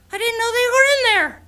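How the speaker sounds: high, loud, quick excuse; flustered puppet dragon. Acted. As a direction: blurt it out guiltily. Grork after biting fingers.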